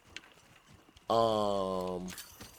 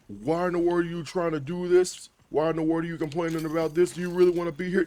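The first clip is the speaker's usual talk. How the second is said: mock scolding; 6 semitones higher, faster than usual. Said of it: "just go like".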